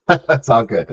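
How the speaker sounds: laughing while talking. Real, not acted. Laughing reply to a joke.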